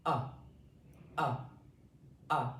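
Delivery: short clipped uh, uh, uh; short uh vowel. Shown as a demonstration. Teacher models the last vowel, clipped uh. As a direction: drop the jaw and say uh. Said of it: "the last one we have is uh".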